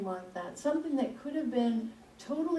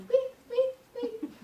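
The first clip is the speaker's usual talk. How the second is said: high squeaky imitation; 13 semitones higher, slower than usual. Imitating the car squeak for the congregation.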